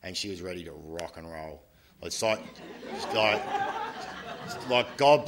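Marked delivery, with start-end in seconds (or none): 2.0-5.3 laughing. Real, not acted. Laughing between thoughts.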